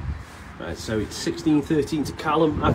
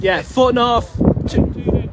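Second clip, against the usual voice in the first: high loud call of distance; 12 semitones higher, 11 dB louder than usual. Real, not acted. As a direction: shout the distance across. Calling distance to player.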